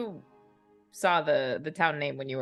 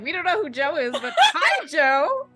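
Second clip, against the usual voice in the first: cheery family chorus; 8 semitones higher than usual. Acted. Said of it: "The rest of the DeMarcos are just like".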